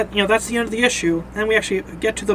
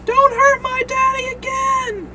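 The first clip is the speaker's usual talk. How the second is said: drawn-out, very high-pitched cry; high, strained yell at moderate volume; acted child's yell. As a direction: yell it slowly like an upset boy.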